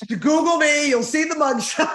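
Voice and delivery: high, loud, laughing boast; laughing joke. Real, not acted. Guest joking about getting in fist fights, laughing and loud. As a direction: call it out loudly, breaking into laughter.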